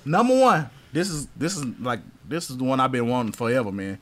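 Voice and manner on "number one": pitched up high and louder, hyped reveal; excited announcement. Real, not acted. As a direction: announce your number one pick with energy. Announcing top pick on list.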